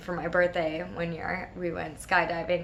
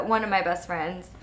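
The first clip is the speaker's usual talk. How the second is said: normal talking voice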